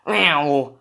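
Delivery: a playful mouth-made car zooming past; car sound effect. Pretend. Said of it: "that's my car sound effect".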